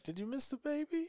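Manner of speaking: high, soft mock-wife voice; imitated wife. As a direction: lightly coo it in a high voice, as the wife. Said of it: "she's gonna be like".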